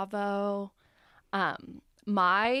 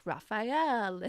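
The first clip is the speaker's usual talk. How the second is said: playful mock Spanish accent; fake Spanish accent. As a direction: roll the name out in a pretend Spanish accent. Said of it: "a fake Spanish accent".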